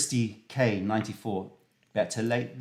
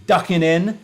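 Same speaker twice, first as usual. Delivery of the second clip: excited welcome; 5 semitones higher, 6 dB louder than usual. Acted. Host greets chat excitedly.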